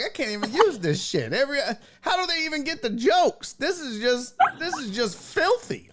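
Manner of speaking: high-pitched retold dismay; exasperated disbelief. Comedian editing his own filthy videos, exasperated disbelief.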